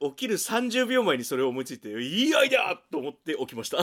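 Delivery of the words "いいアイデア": higher, louder eureka exclamation; excited. Retold or imitated. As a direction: blurt out a great idea. Excited waking thought.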